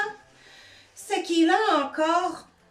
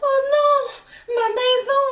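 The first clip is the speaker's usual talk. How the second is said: much higher, louder cry of loss; dismayed exclamation; 10 semitones higher, 6 dB louder, slower than usual. A narrator's reading. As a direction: wail over the ruined house. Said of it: "s'exclame-t-il".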